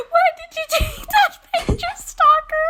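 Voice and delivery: high, loud quote through laughter; laughing retelling. Retold or imitated. Reading a friend's stalker text aloud, laughing hard. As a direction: read it out loud and high while cracking up.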